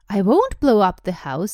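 loud, firm protest. A narrator's reading. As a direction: protest it loudly as a storybook boy. Boy protests to aunt and uncle.

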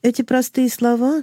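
ordinary delivery, nothing marked

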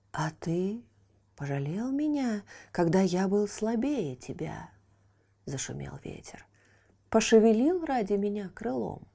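soft, airy, mocking whisper; whispering wind. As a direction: hiss it out like rustling wind. Wind answers.

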